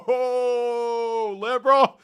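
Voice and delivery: high, loud mock cry; a whiny, mock-tearful jeer; mock wail. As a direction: wail the taunt through laughter. Mock crying taunt at chat.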